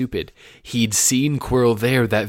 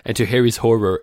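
ordinary delivery

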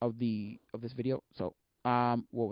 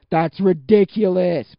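very loud, slow, raised pitch; incredulous outburst; 5 semitones higher, 14 dB louder, much slower than usual. Real, not acted. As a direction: belt out each word in disbelief. Emphatic repeat, amazed at rates.

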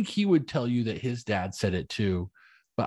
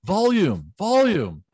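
each volume climbs higher and louder; rising volume calls; 9 semitones higher, 7 dB louder, slower than usual. Retold or imitated. Calling out volume to the family, louder each time. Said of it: "say the word volume".

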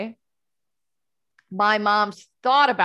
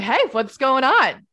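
cheery greeting; 6 semitones higher, 6 dB louder, slower than usual. Retold or imitated. Cheerily answering mom's phone call.

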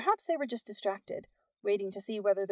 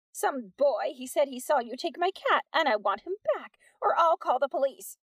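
high, louder threat; Peg threatening; 5 semitones higher, 4 dB louder than usual. Acted. Peg threatening Missus Wack, high and indignant. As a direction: threaten to call the police.

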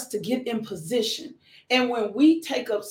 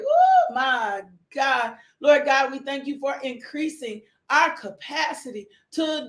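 fervent prayer; 4 semitones higher than usual. Acted. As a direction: pray it out with full force, rising. Leads an opening prayer.

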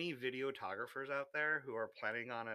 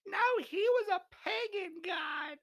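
mocking listener. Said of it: "gonna be like".

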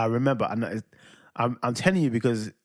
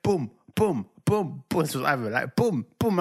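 imitated banging; 3 semitones higher, 3 dB louder, slower than usual. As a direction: boom boom said loud and punchy. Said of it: "I just heard lots of banging".

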